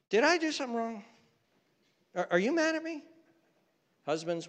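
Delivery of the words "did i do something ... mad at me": anxious spouse. Retold or imitated. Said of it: "spouse asking if partner is mad".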